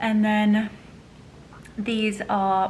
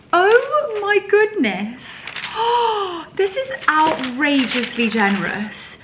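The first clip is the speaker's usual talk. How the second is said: high, loud and slow exclamation; real delighted gasp of words; excited; 6 semitones higher, 7 dB louder than usual. Real, not acted. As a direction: let it burst out in delight. Opening gift.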